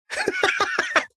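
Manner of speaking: a sudden high burst of laughter at the irony; short high incredulous laugh; disbelieving laugh. Real, not acted. Bully's wife revealed.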